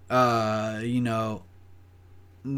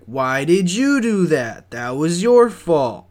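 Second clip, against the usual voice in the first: loud, slow, higher-pitched comeback; retold angry retort; 5 semitones higher, 9 dB louder than usual. Dog walker blaming coworker back, loud retold retort. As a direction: throw the blame back, drawn out and loud. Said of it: "And I'm like".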